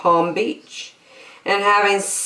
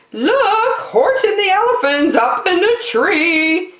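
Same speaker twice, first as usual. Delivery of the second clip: gleeful taunt; 14 semitones higher than usual. Acted. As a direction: jeer at him with glee. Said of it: "shouted with glee".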